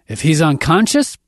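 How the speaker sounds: heated and forceful; furious announcement. A narrator's reading. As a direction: announce it with open fury. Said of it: "I announce furiously".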